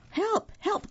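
very high, squeaky rabbit cry; crying for help. A narrator's reading. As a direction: yelp it high in mock panic. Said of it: "cried Rabbit".

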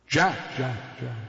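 lightly voiced eerie ghost whisper; a faint spectral call from the fog; ghostly whisper. A narrator's reading. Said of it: "a voice whispered back".

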